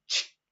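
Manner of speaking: quick hissed "ch"; spark sound. Shown as a demonstration. Teacher drills the cannon sequence, imitated spark. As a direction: imitate a fuse being lit. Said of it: "prende la, la chispa".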